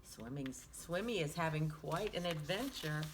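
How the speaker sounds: ordinary delivery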